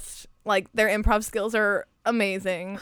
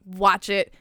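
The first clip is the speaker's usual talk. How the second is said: normal talking voice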